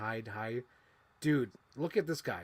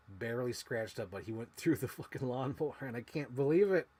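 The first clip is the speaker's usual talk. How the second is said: ordinary delivery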